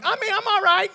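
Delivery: high-pitched retold call; mock all-is-well call; calling out reassurance. Calls out to knocking nurses.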